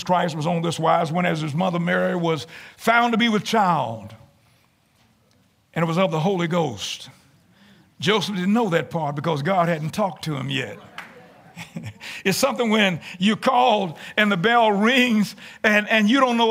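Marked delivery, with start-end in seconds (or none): none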